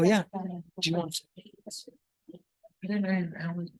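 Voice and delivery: ordinary talk, nothing marked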